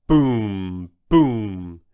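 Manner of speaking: deep heavy booms for giant steps; booming footsteps. A narrator's reading. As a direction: boom out each step like a giant stomp.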